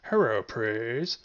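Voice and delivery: slow loud proclaiming cheer; drawn-out shout. Real, not acted. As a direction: stretch it out and shout it. Cheering his catchphrase.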